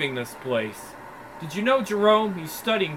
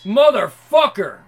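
drawn-out loud curse; slow loud curse; 2 semitones higher, 9 dB louder than usual. Acted. Thug cursing the intruder who just dropped in, slow and loud. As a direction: spit it out slowly and loudly. Thug confronting sudden arrival.

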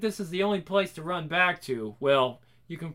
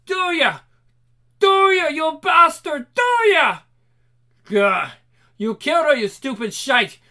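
high, loud raging accusation; furious grief; 9 semitones higher, 7 dB louder than usual. Acted. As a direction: scream out rage and grief. Grieving man berates killer.

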